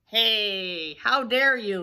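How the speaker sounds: loud, indignant turkey voice; gobbling turkey. Acted. Turkey is bumped by the runners, loud indignant gobble. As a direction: bellow it like an affronted turkey. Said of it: "he gobbled".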